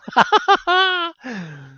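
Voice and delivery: a high, squealing burst of laughter; real shocked laugh. Real, not acted. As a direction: crack up in disbelief. Laughing at a banned follower.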